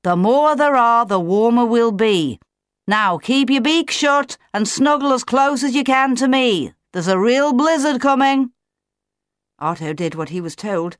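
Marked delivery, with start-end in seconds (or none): 0.0-8.5 raised, projected fatherly orders; booming. A narrator's reading. Claudius to the chick as a blizzard comes, booming. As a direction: call out firmly over a coming storm. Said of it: "Claudius boomed back".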